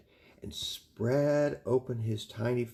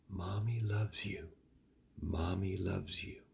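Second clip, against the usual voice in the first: soft whisper; 3 semitones lower, 7 dB quieter than usual. Acted. Mother's comforting words, thought.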